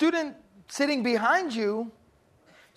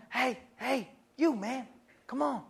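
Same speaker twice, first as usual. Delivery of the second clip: hushed calling; 6 dB quieter than usual. Retold or imitated. Classmate calling in whisper.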